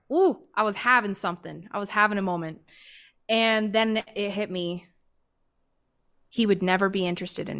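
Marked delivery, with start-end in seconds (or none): none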